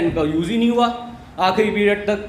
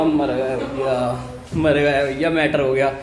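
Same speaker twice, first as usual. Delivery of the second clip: much lower, slow doomed groan; mock despair; 8 semitones lower than usual. Boy to himself after the note, low despairing groan. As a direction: lament like it's over. Panic at getting caught.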